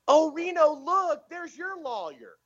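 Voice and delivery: very high, sing-out delighted mom voice; sweet, positive stepmom. Retold or imitated. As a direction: imitate a stepmom proudly spotting something nice, ending drawn out. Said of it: "she'd always find the positive things".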